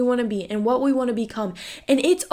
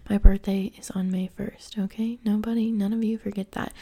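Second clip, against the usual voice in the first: whispering. Real, not acted. Aside about her birthday.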